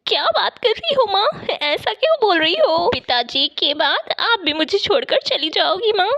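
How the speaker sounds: high, fast, pleading daughter voice; a frightened child begging her mother not to go; distressed girl. Acted. Daughter begs her dying mother, high and pleading.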